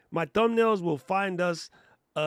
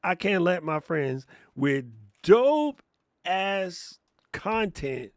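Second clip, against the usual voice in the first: ordinary talk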